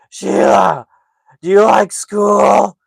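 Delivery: loud, high, drawn-out mockery; mocking imitation. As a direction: make fun of him like a taunting little brother. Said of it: "makes total fun of him".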